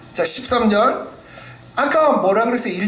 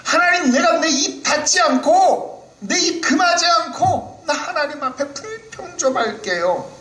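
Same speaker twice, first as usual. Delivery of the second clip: put-on much higher, childlike pleading voice; cute complaining Job; 13 semitones higher than usual. Job playfully announcing to God that he will complain. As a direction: whine to God like a spoiled child. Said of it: "지금 뭐라고 말하는 겁니까".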